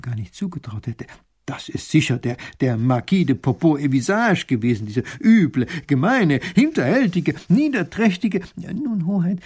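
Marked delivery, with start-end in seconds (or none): 1.5-8.4 loud rant climbing higher; building anger, louder with each insult; furious. A narrator's reading. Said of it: "wird so wütend, wie man es ihm". 8.6-9.4 high, hesitant professor; halting, placating scholar's voice; trying to soothe. A narrator's reading. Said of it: "versucht der Professor, ihn zu beruhigen".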